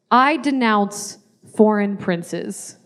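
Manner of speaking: slow, solemn mock oath; all seriousness. New citizen swearing the oath, mock-solemn seriousness. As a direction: pledge it gravely, word by word. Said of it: "with all seriousness".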